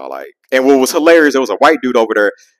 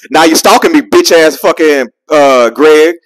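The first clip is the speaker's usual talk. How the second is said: retold angry insult; 5 semitones higher, 5 dB louder, slower than usual. Insulting Greg in retold argument.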